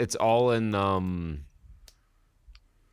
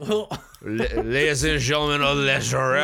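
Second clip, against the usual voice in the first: loud mock French accent; 4 semitones higher, 4 dB louder than usual. American stumbling before the jury, in fake French accent. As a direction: stammer into a hammed-up French accent. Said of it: "like, a French accent".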